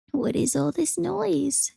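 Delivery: put-on slow old woman voice; a creaky old woman at the door.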